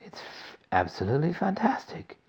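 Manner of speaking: breathless awe; gasp. A narrator's reading. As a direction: catch your breath on it. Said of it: "gasped Grandpa Joe".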